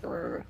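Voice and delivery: low, soft mock growl; imitated reluctant grumble. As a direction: grumble under your breath like a reluctant husband. Imitates husband's reluctant reaction.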